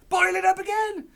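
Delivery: high, loud excited cry; Mister Cranky cries out high and loud; cried out. Acted. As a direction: yell it over the stove. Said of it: "cried Mister Cranky".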